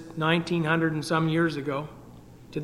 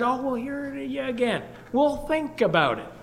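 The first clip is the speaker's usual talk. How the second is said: higher-pitched, lightly mimicked brush-off; mock putting-off; 8 semitones higher than usual. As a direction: imitate people politely putting it off. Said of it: "There was people that said".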